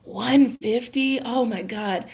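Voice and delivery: slow, stunned disbelief. Retold or imitated. Retelling her disbelief at a cheap house price. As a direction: drag it out in shock. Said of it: "And I was like".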